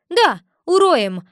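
loud, high, slow echo of a threat; putting on bravado. A narrator's reading. Nervous girl backs up her familiar, with bravado. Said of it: "храбрилась я, нервно переступая".

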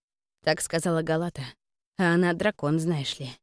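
usual speaking voice

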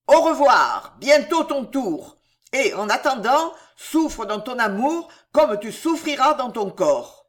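loud, high threat shouted back; vociferating threat. A narrator's reading. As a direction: hurl the curse from horseback. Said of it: "il se retourne sur sa selle et vocifère". (menace vociférée)

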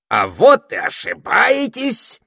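high, loud, drawn-out gloating; slow, ringing cry of triumph; triumphant proclamation. A narrator's reading. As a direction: crow it triumphantly, stretching the words. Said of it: "торжествующе провозгласил Этельред".

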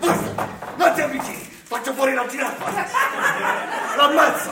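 loud, high hammed-up threat; mock hostage taker. Santa threatening to pop the giraffe balloon, loud mock menace. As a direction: play a desperate hostage taker.

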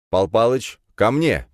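loud sharp command; commanding call. Acted. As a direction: call the cat to heel. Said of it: "скомандовал я".

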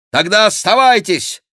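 loud, high retort; shouted retort. A narrator's reading. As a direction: throw it back loudly. Chicot shouts back at monk. (громкий ответ на скаку)

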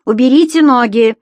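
loud, sharp order to lift the feet; barked command. A narrator's reading. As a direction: snap it out like a strict landlady. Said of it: "Затем она скомандовала".

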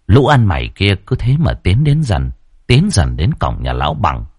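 ordinary delivery, nothing marked

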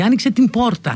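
«την πόρτα» με ν και άηχο π; χώρισε καθαρά το «την» από το «πόρτα»; λάθος προφορά. Shown as a demonstration. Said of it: "τα ακούτε όμως και το δέχεστε". («πόρτα» με σκληρό π)

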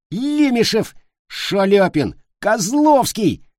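put-on very high-pitched boyish yells; passionate cries. A narrator's reading. Boys pillow-fight over favourite singers, passionate yelling. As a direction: holler the names like battle cries. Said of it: "сопровождались страстными воплями".

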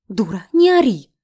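loud snapping rebuke; angry bark. A narrator's reading. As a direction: bark it at her. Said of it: "рявкнула на неё стоящая рядом девушка".

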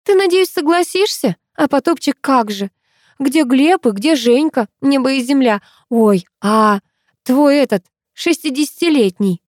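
put-on high, excited girlish babble; chattering on. A narrator's reading. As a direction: pile up questions eagerly. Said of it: "Арина трещала об этом всю дорогу".

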